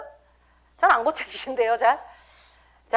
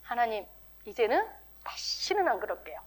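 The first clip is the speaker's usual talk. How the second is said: contrite promise; 9 dB quieter than usual. Acted. Believer finishing repentance before God, contrite promise. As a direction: promise softly, like a chastened penitent. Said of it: "마지막에 이제 회개 다 끝났어요".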